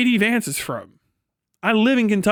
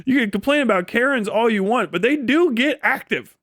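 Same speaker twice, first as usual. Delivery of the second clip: normal talking voice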